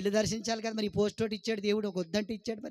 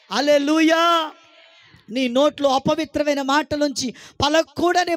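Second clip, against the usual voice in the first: loud, much higher shouted prayer; full-force preaching; 6 semitones higher, 12 dB louder than usual. Acted. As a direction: cast the devil out at full voice. Shouted prayer against Satan.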